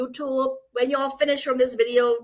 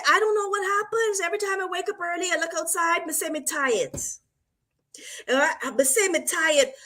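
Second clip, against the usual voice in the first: higher, imitating the client in a Jamaican accent; 5 semitones higher, 3 dB quieter than usual. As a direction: retell the client's complaint in a light Jamaican accent. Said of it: "This Jamaican".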